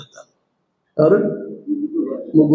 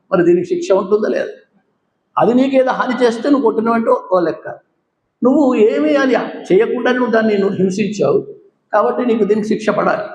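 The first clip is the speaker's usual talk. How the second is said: higher-pitched, rebuking the sadhu; indignant scolding; 6 semitones higher than usual. Acted. Lakshmana scolding the sadhu who stoned the dog, raised indignant voice. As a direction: demand why he hurt the dog.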